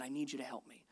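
very soft, low plea; humble reverence. Acted. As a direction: pray it quietly, humbly. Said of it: "David came before God humbly, with fear, with reverence".

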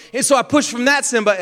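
ordinary talk

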